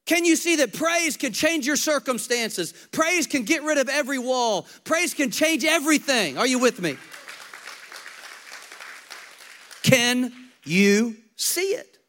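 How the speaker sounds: loud, high preaching that drives each point home; full-force preaching. Acted. Pastor rousing the congregation, loud preaching. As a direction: preach it hard, almost shouting.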